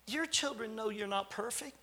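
ordinary delivery

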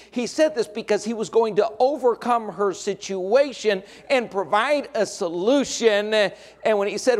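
usual speaking voice

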